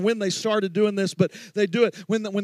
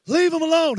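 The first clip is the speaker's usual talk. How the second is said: higher, ringing repetition; preached cry; 7 semitones higher than usual. Shown as a demonstration. Pastor repeats the line up high to the congregation. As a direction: lift it up as a cry. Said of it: "How many of you received that".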